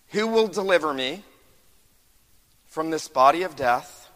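impassioned pulpit preaching; preaching at full force. Acted. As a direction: cry out Paul's struggle to the church. Voicing Paul's inner struggle.

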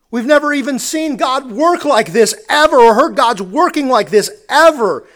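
loud, astonished outcry rising through the list; amazed crowd. Retold or imitated. Preacher voicing the amazed crowd, loud astonishment. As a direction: voice the crowd's disbelief at the miracle. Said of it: "They are left saying".

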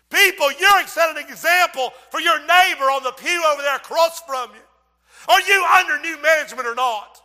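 loud, high, scolding sermon; loud high preaching rebuke; preaching at full force. Acted. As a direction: thunder it at the congregation. God scolding the pastor, preaching.

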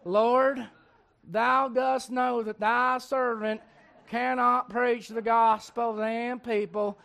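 slow, exaggerated Southern drawl. Moses pleading with the Lord, in a slow Southern drawl. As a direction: stretch it out like a mock Southerner. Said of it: "that means he was from the South".

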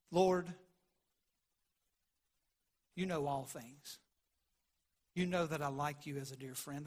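hushed hurt reply; grieved Peter. Retold or imitated. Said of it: "the tone of his voice ... grieved Peter".

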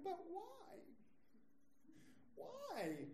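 high, whiny complaining why; pleading whine at bad tax news. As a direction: whine it like a frustrated kid. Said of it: "I was whiny".

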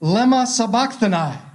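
high, loud cry of anguish; Jesus crying out from the cross, loud and high. Acted. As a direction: cry it out like a dying man. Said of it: "He cried out with a loud voice".

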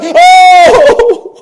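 loud, high wailing "oh"; drawn-out wail of someone praying in pain; pained groan. Acted. Preacher shows prayer when in pain, loud wail. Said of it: "you've been in pain before where when you pray".

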